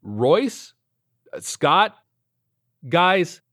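loud, high calling of names. Acted. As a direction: call the names out loudly. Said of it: "I called out".

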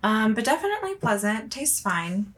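usual speaking voice

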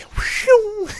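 high whooshing noise; imitated whoosh. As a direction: make something whoosh by overhead. Imitated whoosh over someone's head.

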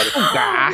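sharp surprised gasp. Real, not acted. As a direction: gasp in realization. Sudden realization.

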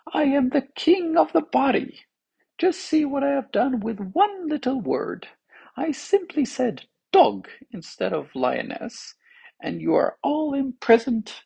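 very high-pitched, triumphant character voice; boastful tongue. A narrator's reading. Tongue claims power over the body, triumphant.

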